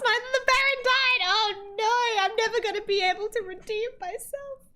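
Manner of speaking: mock dramatic character. Player voices a nun blaming a monk, hammed-up. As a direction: overplay the guilt. Voicing game accusation dramatically.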